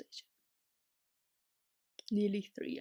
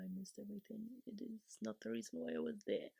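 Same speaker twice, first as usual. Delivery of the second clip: ordinary talk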